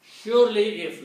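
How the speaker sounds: a teacher's loud, emphatic gloss; emphatic surely if. Shown as a demonstration. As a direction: drive home the meaning "Surely if". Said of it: "so this becomes surely if".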